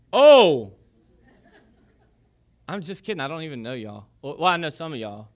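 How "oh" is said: a sudden loud "Oh!" at his own joke; hammed-up gasp of surprise; mock shocked gasp. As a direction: gasp as if caught out.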